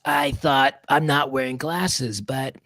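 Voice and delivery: slow, laid-back surfer drawl; surfer-style Whoopi impression. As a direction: stretch it out like a surfer. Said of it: "She sounds like a surfer".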